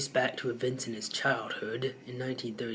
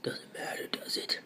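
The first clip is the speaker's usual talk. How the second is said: whispered aside; 9 dB quieter than usual. Real, not acted. Muttered aside.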